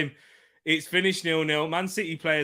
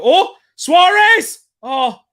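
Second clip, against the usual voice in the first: a sudden, much higher and louder, stretched-out yell; real excited shout; 14 semitones higher, 13 dB louder, much slower than usual. Real, not acted. Fan watching Suarez's chance, shouting out excitedly. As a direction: jump out of your seat at the chance.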